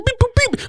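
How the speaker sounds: high electronic beeps; beep boop sounds. Retold or imitated. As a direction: chirp it like a machine. Imitating the bar machine.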